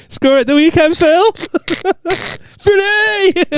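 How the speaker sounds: high, cracking up mid-line; squeaky laughing cheer; laughing fan banter. Retold or imitated. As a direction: call out through giggles. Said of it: "I was like".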